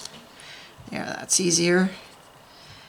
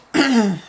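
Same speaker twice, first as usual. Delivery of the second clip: quick rough throat clear; 3 semitones higher than usual. Real, not acted. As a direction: clear the throat to shift a frog. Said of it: "I've got a frog in my throat".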